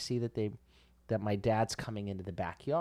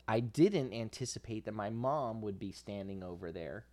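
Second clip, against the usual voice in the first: ordinary talk, nothing marked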